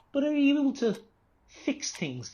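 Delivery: ordinary delivery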